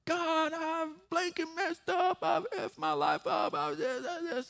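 slow, high, wailed mock prayer; imitated desperate prayer. Man praying R-rated in the street, imitated desperation. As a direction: act out his raw prayer, pitch way up. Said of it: "he just starts praying".

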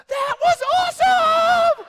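high, loud shout of delight; thrilled kid. Retold or imitated. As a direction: yell it like a kid who just loved it. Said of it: "he looks up and goes".

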